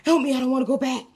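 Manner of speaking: high, rising desperate begging; imitated screaming plea. Dream boy begging friends to save him from hell, screaming plea. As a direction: act out frantic, repeated cries for help. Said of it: "he was screaming at us".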